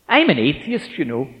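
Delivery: high, loud, combative blurt; aggressive statement. Retold or imitated. Student confronting his teacher, aggressive and defiant. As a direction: throw it out as a challenge. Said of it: "an aggressive statement like".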